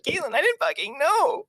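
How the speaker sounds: high-pitched retold protest; exasperated protest. Retelling own protest to Caitlin.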